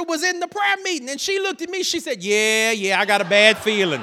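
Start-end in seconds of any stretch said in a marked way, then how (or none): 2.2-4.0 loud mock agreement; hammed-up, louder warning; foreboding sister. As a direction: imitate a sister piling on the dread. Acts out sister joining the panic.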